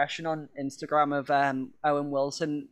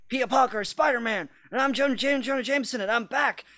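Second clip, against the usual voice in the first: high shouting newspaper boss; J. Jonah Jameson; 7 semitones higher than usual. Retold or imitated. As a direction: bellow it like a ranting editor. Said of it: "even though it was like".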